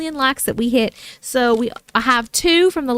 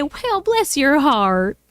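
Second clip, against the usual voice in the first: slow Southern drawl; Southern accent; much slower than usual. Pretend. Host saying a Southern phrase on request, slow Southern accent.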